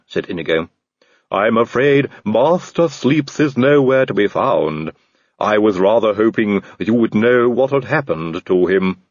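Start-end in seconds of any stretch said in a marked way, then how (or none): 1.3-9.0 slow, polite servant voice with a different timbre; a courteous, slightly sinister butler; Igor the servant. A narrator's reading.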